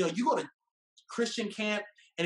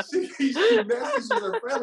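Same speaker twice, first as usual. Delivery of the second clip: high, slowed by laughter; laughing; 5 dB louder than usual. Real, not acted. Telling how she messaged her friend, laughing through the words. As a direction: talk through a real laugh.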